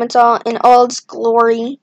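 normal talking voice

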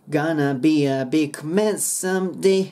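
slow, linked "Gonna be a big man someday"; dropped sounds. Shown as a demonstration. Teacher modelling the lyric line, slow with silent G. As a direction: stretch the line, swallowing the G of "big". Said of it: "ここのGも消えます".

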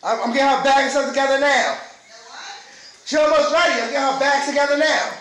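shouting to his wife in the shower; calling out. Real, not acted. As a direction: call out to be heard through the door.

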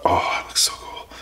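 excited hushed whisper. Real, not acted. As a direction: whisper it in awe. Admiring the new mic.

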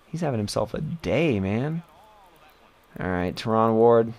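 ordinary talk, nothing marked

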